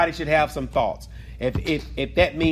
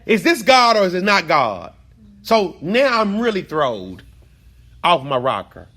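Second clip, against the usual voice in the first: preaching, raised voice; 3 semitones higher, 5 dB louder than usual. Real, not acted. Teacher preaching emotionally.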